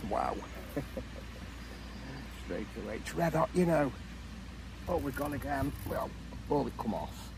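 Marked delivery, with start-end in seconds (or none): none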